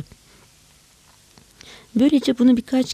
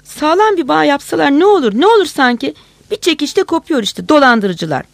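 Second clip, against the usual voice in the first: high, loud furious yell; angry shouting; 6 semitones higher, 6 dB louder than usual. A narrator's reading. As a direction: rage at the cheats who make laces. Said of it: "kızdıkça bağırıyordu".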